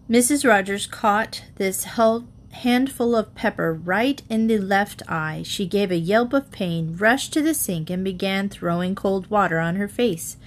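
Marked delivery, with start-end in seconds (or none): none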